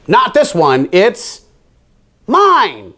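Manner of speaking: rising defiant claim. A narrator's reading. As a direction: Milton refusing to share the worm. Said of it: "croaked defiantly".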